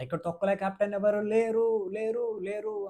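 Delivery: hammed-up imitation of an angry housemate; imitating Suman Shetty. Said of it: "గట్టిగా అడుగుతారనమాట".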